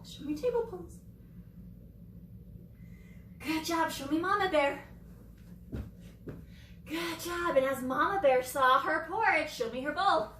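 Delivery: ordinary talk, nothing marked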